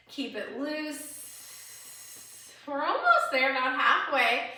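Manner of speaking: rally them through the last stretch; cheering on. Real, not acted.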